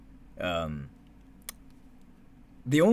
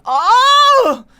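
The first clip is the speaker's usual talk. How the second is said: loud, high-pitched drawn-out "oh"; imitated scream; 15 dB louder than usual. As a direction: mimic dad screaming at full volume. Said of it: "He was screaming".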